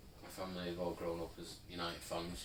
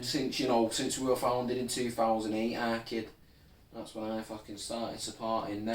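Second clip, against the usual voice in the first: loud, higher Manchester lad voice; chavvy Mancunian; 4 semitones higher, 8 dB louder than usual. Acted. Fake City fan answering a question, loud Mancunian. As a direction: brag in a chavvy Manc accent. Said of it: "chavvy Mancunian accent".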